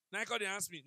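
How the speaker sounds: usual speaking voice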